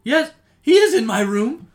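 high, loud stammering realization; flustered loud mother. Acted. Mother shouting back at the accusing player. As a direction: blurt it out, caught off guard.

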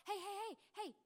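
a parent calling out to grab notice; calling out hey. Acted.

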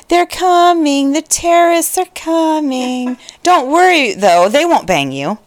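creepy sing-song. Retold or imitated. Sleep talker warning partner after a forehead kiss, creepy sing-song. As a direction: lilt it creepily, dropping at the very end. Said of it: "in a really creepy sing-songy voice".

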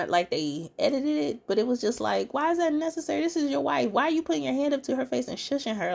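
can't believe what she saw; indignant disbelief. Real, not acted. Reacting to Jamie shushing his wife, high indignant disbelief. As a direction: pitch climbing high in outrage.

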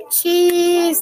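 high, loud happy cheese for the photo; joyful cheese. A narrator's reading. Family posing for a photo, joyful. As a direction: beam it out for the camera. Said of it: "they all said in joy".

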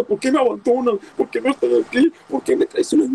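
high, whiny mock lament; a hammed-up whimper, pitched high; mock wailing complaint. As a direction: parody a coward wailing at being abandoned.

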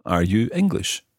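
ordinary delivery

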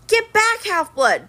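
high urgent command; commanding order. A narrator's reading. Zoe ordering Annabeth out of the way, commanding. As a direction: order her back sharply. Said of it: "the girl with the circlet said".

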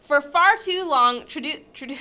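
ordinary delivery

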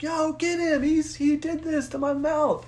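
very high, slow, mock-hysterical yell; frantic calling out. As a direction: act out a frantic tattletale calling for help. Calling paramedics to catch him.

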